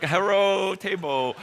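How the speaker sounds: "Hello" as "herro" in a mock Chinese accent; halting mock Chinese-accented learner's English. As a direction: play a proud beginner with a Chinese accent. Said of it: "Look what I taught Yan Fan".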